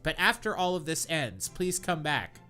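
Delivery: usual speaking voice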